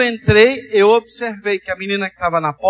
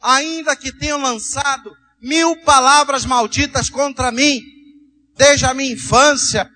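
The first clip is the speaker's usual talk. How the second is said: raised, impassioned preaching voice; fervent prayer; 4 semitones higher than usual. Acted. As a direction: declare it to God with conviction, pitched high. Leads a fervent declaration of faith.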